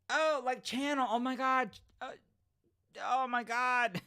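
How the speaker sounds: high, loud burst of dismay; mortified realization. Real, not acted. Host realizing the name was said wrong, high mortified exclamation. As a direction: cry out as the mistake dawns on you.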